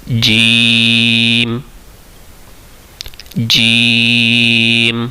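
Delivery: جیم held long and steady, each sound drawn out; very slow letter recitation. Shown as a demonstration. Teacher recites جیم slowly for Quran students. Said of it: "equal to one الف ہم اس کو بھی".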